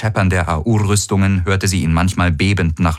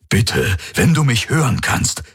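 urgent plea, pitched higher; pleading; 6 semitones higher than usual. Acted. Hawks begging his possessed friend, pleading. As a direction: beg him to come back to himself. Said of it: "flehte Hawks".

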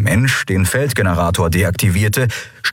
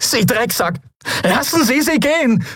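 high-pitched outraged yell; angry shout. A narrator's reading. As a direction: yell a desperate demand at the captor. Captive yells at captor.

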